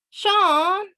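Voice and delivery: high, loud, drawn-out call with a rising end. A narrator's reading. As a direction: call the name from another room. Hidden girl calls out for Sean.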